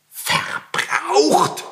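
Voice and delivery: high, breathless shriek of disbelief; shrieked outrage. A narrator's reading. Old landlord hears his stairs were burned, shrieking. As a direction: screech the word in horror. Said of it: "schrie der Alte".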